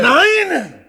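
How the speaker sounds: shouted high and loud; furious refusal. Acted. Clown shouting his refusal at the host. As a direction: bark the no.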